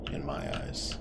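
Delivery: whispered, very low trail-off; sudden whisper. Acted. Suspect whispers his verdict to the Cutter. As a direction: let it fall to a hush.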